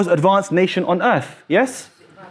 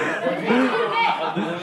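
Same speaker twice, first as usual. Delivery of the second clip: real laugh; 3 semitones higher, 6 dB quieter than usual. Real, not acted. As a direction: laugh helplessly at your own joke. Still laughing at the joke.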